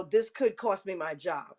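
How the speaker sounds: normal talking voice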